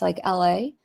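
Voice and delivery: normal talking voice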